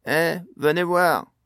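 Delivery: loud call from the next room. A narrator's reading. Pod calling his friends from the living room, loud call. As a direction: call them over, loud and drawn out. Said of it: "appela soudain la voix de Pod".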